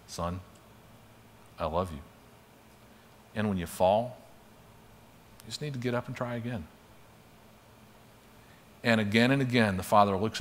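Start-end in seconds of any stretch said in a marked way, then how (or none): 0.1-6.7 tender fatherly voice. Retold or imitated. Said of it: "He says things like this".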